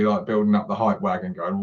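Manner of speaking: ordinary talk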